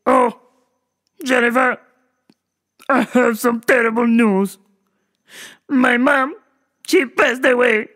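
halting caricature with heavy pauses; Doctor Octopus. Retold or imitated. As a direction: break bad news in a comic put-on accent. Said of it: "I talk like this because I'm Doctor Octopus".

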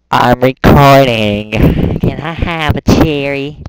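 yelling to be heard over a friend; loud call to a friend in the room; loud shout. Real, not acted. Shouting to a friend while recording.

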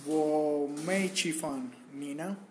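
normal talking voice

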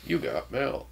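put-on soft computer-style child voice; robot child voice. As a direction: announce it like a robot kid. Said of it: "talking like a robot child".

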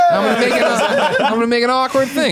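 high and loud, laughing through it. Real, not acted. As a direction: giggle the words out, barely holding it together. Joking, laughing mid-story.

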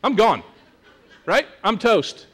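resigned. Shown as a demonstration. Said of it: "it would be something more like".

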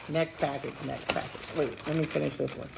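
whispered under the breath; hushed aside to yourself. Real, not acted. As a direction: slip the words out with no voice. Muttered to self.

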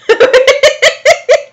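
high-pitched fit of giggles; helpless squeaky laughter; high giggling. Real, not acted. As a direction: giggle uncontrollably. Reader cracks up at story.

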